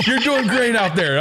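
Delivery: high, slow praise through laughter; laughing mock praise. Coach praising Ben over the headset, mock encouraging. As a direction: imitate fake encouragement while cracking up.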